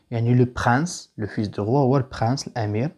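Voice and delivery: normal talking voice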